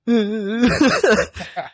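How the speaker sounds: high laugh. Real, not acted. Laughing at the memory.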